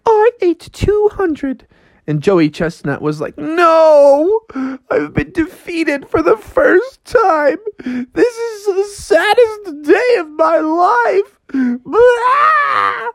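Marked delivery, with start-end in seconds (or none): 0.1-1.7 slow, very high falsetto; Alice. Acted. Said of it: "And she's like". 3.4-13.1 high, slow hammed-up wail; mock crying. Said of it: "And he was crying".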